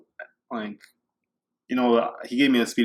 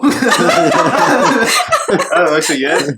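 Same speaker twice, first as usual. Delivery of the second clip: words dissolving into a loud, high laugh; helpless laughter at your own story; breaking into laughter; 8 semitones higher, 10 dB louder, much slower than usual. Real, not acted. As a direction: crack up as you tell it. Laughing at own courtroom dilemma.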